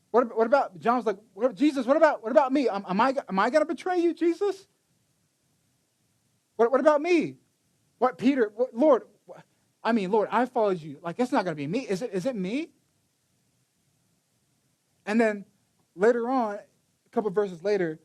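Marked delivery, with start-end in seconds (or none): 1.3-12.7 anxious disciple asking if it's him; pleading disciples. Retold or imitated. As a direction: beg him to say it's not you. John and Peter pleading.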